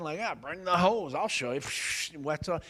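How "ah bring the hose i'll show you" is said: bragging. Retold or imitated.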